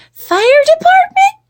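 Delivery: very high, loud, rising question; puzzled little girl. A narrator's reading. Jilly Boo repeating mama's words, high puzzled squeak. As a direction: squeak it back as a questioning child. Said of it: "repeated Jilly Boo".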